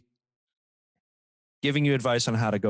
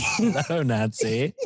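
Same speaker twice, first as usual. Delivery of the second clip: creepy Hannibal voice; much slower than usual. Pretend. Hannibal line parody.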